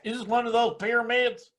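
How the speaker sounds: put-on, exaggerated doubter; hammed-up bad act. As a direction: imitate a badly acted sceptical question. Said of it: "always do it with some bad act".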